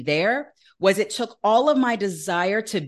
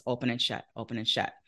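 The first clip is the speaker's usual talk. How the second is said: hushed; 7 semitones lower, 9 dB quieter than usual. Real, not acted. Describing vision softly.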